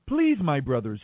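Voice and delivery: ordinary delivery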